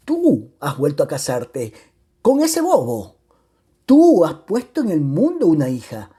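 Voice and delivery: emphatic outrage with hard-stressed "tú"; indignant accusation. Acted. Returned husband accuses remarried wife, indignant. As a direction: throw the question back, accusing.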